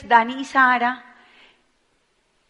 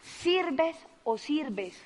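"Sirves o sirves" slow and much softer, each "sirves" stretched; soft repeat; 2 semitones higher, 9 dB quieter, much slower than usual. Shown as a demonstration. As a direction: let the slogan sink in quietly. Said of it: "repeats the slogan softer".

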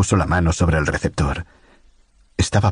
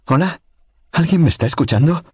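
Lucy calling on the radio; 11 semitones higher than usual. A narrator's reading. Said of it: "empezó a llorar".

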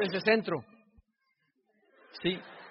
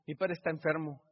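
ordinary delivery

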